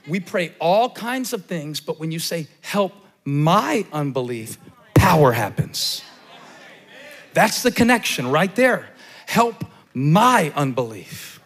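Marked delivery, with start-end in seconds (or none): 2.6-11.4 slow, loud declaration building to a shout; rising preaching. Real, not acted. Preacher declares to the church that power happens, loud and slow. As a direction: let the prayer swell into power. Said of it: "preacher builds to a shout".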